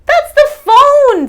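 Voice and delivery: high, loud, slow cousin voice. Acted. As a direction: point out the phone. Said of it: "said Cousin Okolu".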